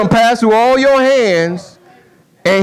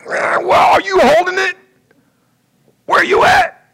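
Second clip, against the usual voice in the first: strained shout; 10 semitones higher than usual. Pretend. Lifter shouting at absent helper, high strained yell. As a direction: yell for the helper who let go. Straining friend calling out mid-lift.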